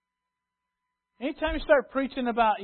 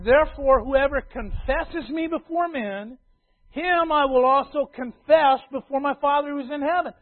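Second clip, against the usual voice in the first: scripture proclaimed loud and higher; booming preacher reading; preaching; 7 semitones higher, 8 dB louder than usual. Acted. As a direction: ring it out like the pulpit verse it is. Quoting Jesus from the pulpit.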